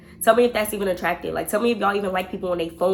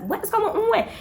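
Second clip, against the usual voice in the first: much higher alarmed question; mock alarm. Her reacting to friend's fake accident call, mock alarm. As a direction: play up fake shock.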